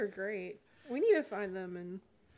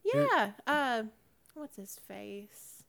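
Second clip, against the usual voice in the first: ordinary delivery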